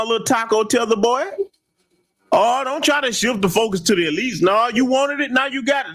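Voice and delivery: usual speaking voice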